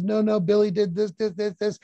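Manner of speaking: put-on high, whiny child voice tattling on a brother; tattling kid. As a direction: play a little kid blaming the other. Other kid blaming back.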